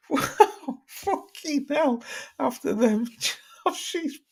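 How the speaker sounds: high, breaking up with real laughter; shocked laughter. Real, not acted. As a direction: gasp out the words through helpless laughs. Shocked laughter at a chat joke.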